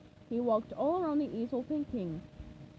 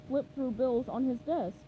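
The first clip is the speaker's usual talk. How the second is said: plain reading voice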